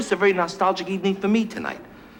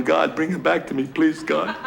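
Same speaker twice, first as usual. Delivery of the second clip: mock crying prayer; 3 semitones lower than usual. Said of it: "And I'm going".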